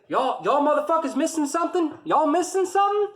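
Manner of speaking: high, loud mocking yell; yelling drill sergeant. Drill sergeant grilling the platoon, high loud yell. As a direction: bark it at the whole platoon. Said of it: "started smoking the shit out of".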